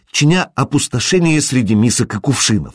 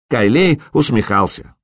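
ordinary delivery

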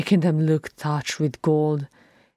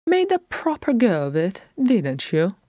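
high, lightly chiding voice dropping at the end; casual, higher-pitched teasing remark; Bilbo, conversational; 5 semitones higher than usual. A narrator's reading. Bilbo surveying the wrecked workbench, high conversational chiding. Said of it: "said Bilbo conversationally".

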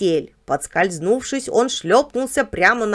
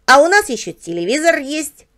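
higher-pitched, proud character voice; boastful; 7 semitones higher, 4 dB louder than usual. A narrator's reading. Dog boasts about the television, proud. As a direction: show off a prized possession. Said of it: "похвастался Бобик".

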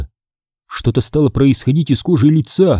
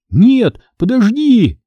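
high, gasped plea; breathless gasp; 9 semitones higher, much slower than usual. Acted. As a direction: blurt it out on a sharp breath. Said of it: "выдохнул я". (выдохнуть в испуге)

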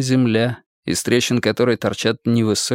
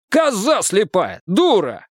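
angry cursing; 8 semitones higher, slower than usual. Acted. Driver curses after crash.

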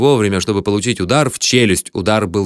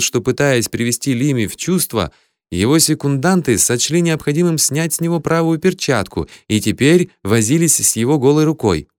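ordinary delivery

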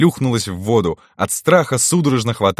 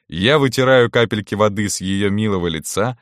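ordinary delivery, nothing marked

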